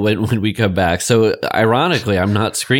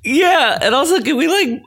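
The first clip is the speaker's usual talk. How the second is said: excited. Real, not acted. Excited jump in pitch.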